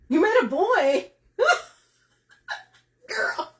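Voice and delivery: giddy laughing tease; excited tease. Real, not acted. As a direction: squeal with delight at the news. Teasing viewer about boy.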